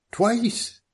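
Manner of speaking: high, pointed insistence; insistent. Acted. Middleton correcting the detective, insistent. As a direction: insist on it, pitch jumping up. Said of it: "Mister Middleton insisted".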